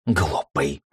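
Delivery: usual speaking voice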